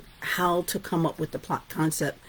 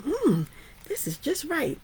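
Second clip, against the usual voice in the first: put-on high, pleased little girl voice; Goldilocks pleased; 8 semitones higher than usual. Storyteller voicing Goldilocks finding perfect porridge, high and pleased. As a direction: savor it like a happy child.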